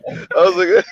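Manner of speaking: high shouted laugh. Real, not acted. Friend laughs recalling the fan lady. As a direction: burst out laughing at it. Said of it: "laughing at the story".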